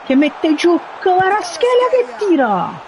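high, urgent shout of the shot; excited shot call. Real, not acted. Shot on goal.

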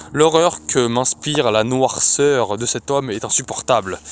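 usual speaking voice

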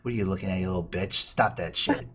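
a sassy dog snapping at its owner. Acted. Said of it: "Gabby has such sass".

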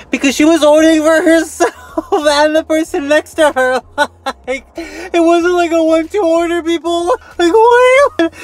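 high, laughing mock outrage at a greedy order; squealing in disbelief, laughing through it; exasperated squeal. Vlogger mocking a woman ordering at the drive-thru, squealing high.

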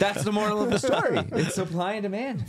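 laughing, lightly put-on posh British voice; mock British accent. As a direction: play at being a British gent, giggling. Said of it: "Could you talk more in that accent".

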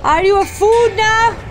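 loud, high calls into the dark barn; loud searching calls; calling out. Real, not acted. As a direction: shout for the missing man, half scared. Calling out for missing Drew.

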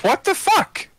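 high-pitched startled exclamation; shocked. Acted. Player's character sees the big four-armed thing, shocked and high-pitched. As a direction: squeak it out in hammed-up disbelief.

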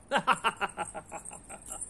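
short, falling chuckle at his own joke; a self-satisfied little laugh; laughing at the punchline; pleased laugh. Real, not acted.

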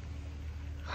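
sharp breathless gasp of dismay; shocked gasp. Real, not acted. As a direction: catch your breath in real shock. Camera just broke, shocked.

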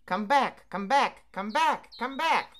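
high, loud duck-like call; quacking come-back bird. Acted. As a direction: call them home like a duck.

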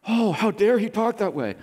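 hammed-up huffy outrage; mock offended. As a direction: play the affronted priest for show. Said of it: "he looks offended".

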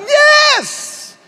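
very high excited cheer of yes; mock cheer. Pastor imitating a fan celebrating a goal, high cheer. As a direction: cheer like your team just scored. Team scores.

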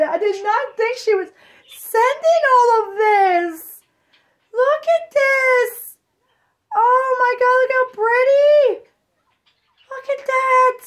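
high, loud tearful gushing; joyful crying. Real, not acted. Thanking a friend's gift for the baby, tearful high gushing. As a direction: voice strained by happy tears.

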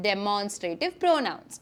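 soft, slow model echo; quiet repeat. Shown as a demonstration. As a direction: repeat it gently for the class to copy. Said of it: "നമ്മൾ പഠിക്കാൻ പോകുന്ന ടോപ്പിക്കിൻ്റെ പേരാണ്".

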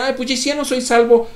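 high, loud mock of a shrugging sinner; mocking careless voice. Pastor mocking those who shrug off salvation, high and loud. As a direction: sneer it out as if it's nothing. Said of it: "aquellos que consideran y dicen".

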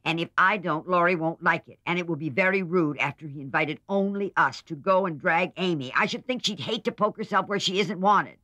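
scornful sister argues back; Joe arguing. A narrator's reading. Joe argues against taking Amy.

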